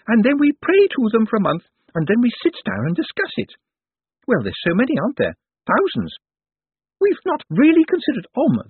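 high, loud, fluttery chatter with hesitations; nervous genteel lady. A narrator's reading. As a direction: gush nervously in a high ladylike voice. Nervous lady invites the reverend.

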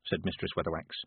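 ordinary delivery

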